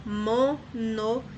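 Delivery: "monossílaba" as MO... NO... SÍ... LA... BA; five isolated pulses with gaps in "monossílaba"; syllable by syllable. Shown as a demonstration. Teacher has pupils count five syllables, beat by beat. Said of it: "Cinco sílabas".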